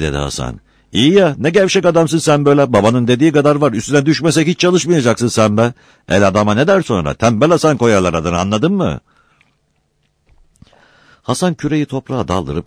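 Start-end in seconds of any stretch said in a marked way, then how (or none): 0.9-9.0 high, loud, driving rebuke; angry scolding. A narrator's reading. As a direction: berate a slack nephew without letting up. Uncle berates lazy nephew. (öfkeyle, yüksek sesle paylama)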